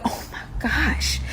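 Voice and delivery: slow, soft, breathy alarm; whispered dread. Retold or imitated. Captive imagining his friend's advice, whispered dread. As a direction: draw it out under your breath.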